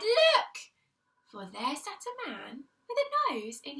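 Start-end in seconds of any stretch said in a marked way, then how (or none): 0.0-0.7 high, loud cry. Acted. Peter finds the thief behind the gate, shouting. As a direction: shout it out, pointing. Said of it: "Peter cried".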